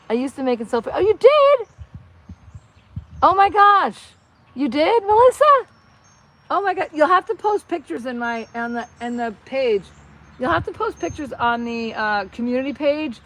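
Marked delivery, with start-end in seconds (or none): 0.9-8.1 high, loud gasp of amazement; delighted surprise. Real, not acted. Streamer learns viewer made costumes too, thrilled surprise. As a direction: exclaim in thrilled disbelief, pitch leaping up.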